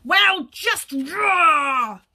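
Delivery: loud, drawn-out wolf explanation; exasperated storybook wolf, roaring. Acted. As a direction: stretch ROAR into a shout. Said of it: "answered the wolf".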